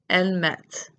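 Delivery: "mettent" with a clearly sounded final T; stronger T. Shown as a demonstration. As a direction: make the T at the end of "mettent" stand up. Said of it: "makes that T stand up".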